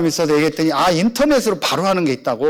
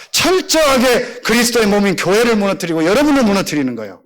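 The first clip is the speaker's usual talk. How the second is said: fervent preaching; 5 semitones higher than usual. Acted. Pastor exhorts the congregation in a fervent preaching voice. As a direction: proclaim it to the whole church.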